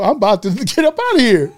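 high, laughing deathbed joke; comic high-pitched protest; laughing mock protest. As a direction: play the indignant dying man, cracking up. Jokes as the dying man.

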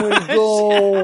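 slow, puzzled monster voice; dopey Trump. Retold or imitated. Mocking Trump losing sight of Jeb, slow puzzled voice. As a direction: wonder aloud like a confused beast.

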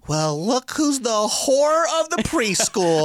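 high, drawn-out mocking taunt; sarcastic jab. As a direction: sneer it slowly like a scorned kid. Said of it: "and you're like".

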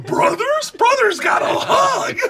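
high excited shout, slowing on the hug. Real, not acted. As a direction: yell it with pure bro joy. Excited brothers hugging, shouting.

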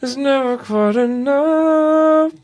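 high, slow dummy voice through still lips; ventriloquist dummy voice. Pretend. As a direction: sing it as the puppet without moving your lips. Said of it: "Can you sing perfect as Danny O'Day?".

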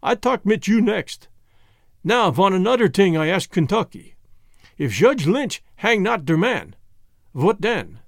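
put-on deliberate Germanic-accented questioner; Jan with thick Germanic accent. Voices Jan with foreign accent.

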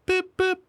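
high two-note beep beep like a phone; mock phone beep; imitated phone beep. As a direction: mimic a text alert, high and short. Phone message arrives.

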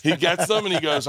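ordinary delivery

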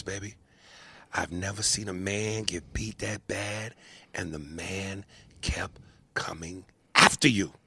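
hushed confiding whisper. Retold or imitated. Trainer Tony urging Apollo to let it go, whispered. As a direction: breathe it softly like a secret. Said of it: "And he goes, (whispered)".

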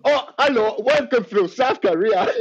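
hammed-up South Korean accent greeting a guest; mock South Korean accent. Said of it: "do a South Korean accent".

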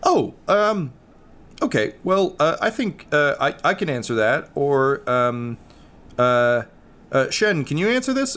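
a big dog caught out, hunting for an answer; flustered dog. Acted. Toby the dog dodging the player's question, flustered and high.

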